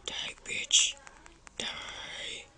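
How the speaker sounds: whispered menace at the monsters. Real, not acted. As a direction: hiss at the enemies.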